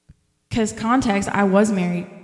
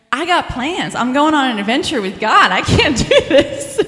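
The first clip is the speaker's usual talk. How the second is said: excited laughing; 5 semitones higher, 7 dB louder than usual. Retold or imitated. Retelling her excited refusal, laughing.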